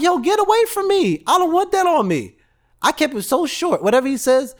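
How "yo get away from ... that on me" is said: much higher, louder inner outburst. Retold or imitated. Speaker's unspoken reaction to the negative guy, high and loud. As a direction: blurt out your private thought. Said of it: "I didn't say it out my mouth".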